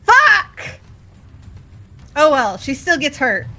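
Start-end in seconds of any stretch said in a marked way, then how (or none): 0.0-0.6 loud frustrated curse; frustrated shout. Real, not acted. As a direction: yell it out in frustration. Bad saving throw roll.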